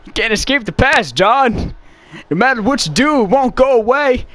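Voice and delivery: hammed-up pained cowboy declaration; John Marston impression. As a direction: build to a high strained cry. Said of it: "his voice is fun to make fun of".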